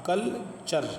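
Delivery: कल्चर said with a clear ch sound; culture with ch. Shown as a demonstration. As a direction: say कल्चर as kul-cher, t turned to ch. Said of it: "बल्कि क्या पढ़ेंगे, चा".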